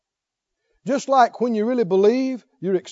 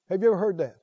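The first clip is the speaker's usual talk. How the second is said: normal talking voice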